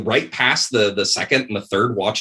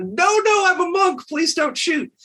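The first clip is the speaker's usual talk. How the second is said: high, frantic begging; panicked plea. Retold or imitated. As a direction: plead for your life, pitched way up. Spotted monk begs again.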